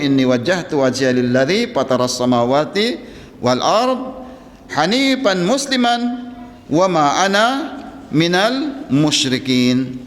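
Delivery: Quranic recitation. Acted. Prophet's prayer quoted in Arabic.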